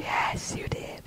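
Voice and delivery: hushed whispered reply; whispering. Real, not acted. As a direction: breathe it out in a whisper. Quick aside to friend.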